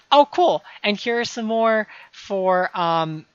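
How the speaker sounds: bright burst of joy; excited. Real, not acted. Finds more hunting targets.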